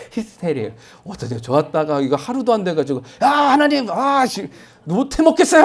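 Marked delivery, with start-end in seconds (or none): 3.2-5.6 high, loud fed-up yell at God; exasperated outburst. Retold or imitated. Believer losing patience with God, loud exasperated cry. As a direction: blurt it out like you've had enough. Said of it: "하면서 이렇게 하는 거".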